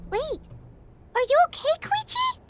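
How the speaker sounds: sharp high rise of concern; worried question. Acted. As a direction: ask with sudden alarm. Noticing a friend is hurt.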